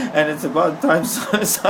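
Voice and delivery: real laugh breaking through. Real, not acted. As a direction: talk while cracking up. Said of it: "That was repressed laughter".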